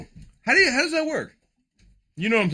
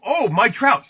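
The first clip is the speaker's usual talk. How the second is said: ordinary delivery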